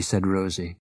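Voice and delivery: ordinary delivery, nothing marked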